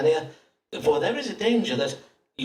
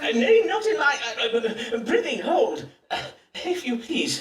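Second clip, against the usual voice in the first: startled protest; 7 semitones higher than usual. Acted. Doctor recoiling from the patient's cup. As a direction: blurt out in alarm, laughing. Doctor alarmed at urine offer.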